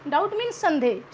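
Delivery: normal talking voice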